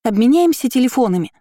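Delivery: high, loud call after him; shouting. A narrator's reading. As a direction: shout it after someone leaving. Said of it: "прокричала Настя ему вслед".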